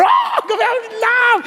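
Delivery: mock swoon, voice leaping way up high; swooning lover. Preacher mocking a giddy romantic, high swooning voice. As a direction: ham up a giddy lovestruck sigh, very high.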